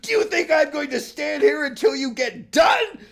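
high, shouted insults, slowed on the names; angry shouting. Acted. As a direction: yell abuse at the frogs. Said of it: "Then he abused the frogs and cried".

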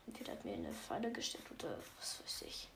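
low suspicious whisper. Real, not acted. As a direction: whisper like you sense a trap. Suspicious aside about a trap.

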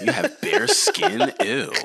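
high, slow, disgusted; judgy woman voice. Retold or imitated. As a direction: sneer it like a picky woman. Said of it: "You know women".

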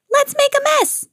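very high, excited bird voice; high storybook glee; gleeful exclamation. A narrator's reading. Bird gleefully joins the mess.

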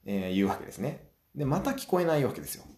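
usual speaking voice

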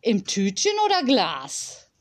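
put-on shopkeeper voice; shop assistant. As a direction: ask it as an obliging shop assistant. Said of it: "würde der Verkäufer fragen".

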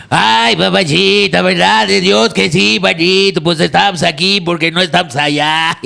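voz de Resortes, lenta y fuerte, cómica; imitación de Resortes. Pretend. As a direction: ponle la voz de Resortes al público. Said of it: "Aviéntate la de Resortes". (imitando a Resortes, cómico y arrastrado)

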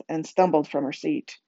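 ordinary delivery, nothing marked

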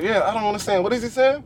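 high-pitched imitation of a confused kid; mock incomprehension, voice lifted high; baffled friend. Friend still lost at dad's words, high baffled imitation.

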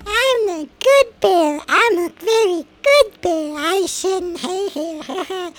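high, slow, pleading mock-crazy voice; mock straitjacket patient, high and drawn out; mental patient. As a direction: plead it slowly like a locked-up patient. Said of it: "in a little straight jacket going".

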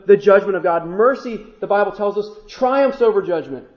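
ordinary delivery

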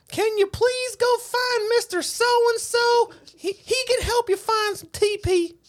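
put-on very high, slow pleading child voice; little kid. Stranded kid asks stranger for help, high child voice. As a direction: squeak it out like a polite stranded kid. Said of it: "this little kid says".

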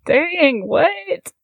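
high, stretched incredulous dang; taken aback. Acted. Player acts out a look at Shift, taken aback. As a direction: let the pitch leap up in disbelief. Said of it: "I kinda give Shift a look".

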